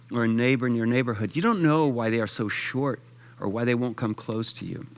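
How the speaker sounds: ordinary talk, nothing marked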